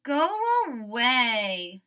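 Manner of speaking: slow, loud grumbling order; sleepy, stubborn grump; grumpy Toad. Acted. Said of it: "said the voice".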